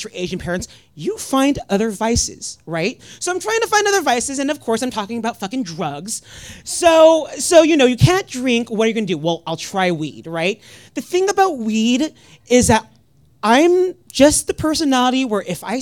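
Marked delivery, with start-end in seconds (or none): none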